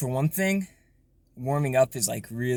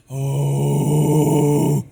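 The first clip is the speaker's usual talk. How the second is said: with more push; louder distorted break; 9 dB louder than usual. Shown as a demonstration. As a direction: let the voice break into air. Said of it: "do it to that voice break".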